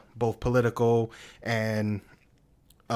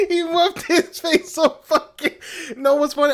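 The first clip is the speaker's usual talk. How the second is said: high, loud, laughing too hard to finish; real laughter squeezing the voice high; cracking up; 5 dB louder than usual. Real, not acted. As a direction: talk through helpless laughter. Cracking up while retelling movie scene.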